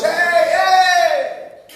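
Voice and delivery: loud, high indignant call; pharisees' shout. Retold or imitated. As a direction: shout them down in outrage. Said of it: "le dicen los fariseos".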